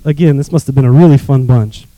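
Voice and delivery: normal talking voice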